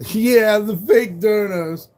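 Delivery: high, loud laughing scoff. Real, not acted. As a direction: laugh it off mockingly. Laughing at fake donation claim.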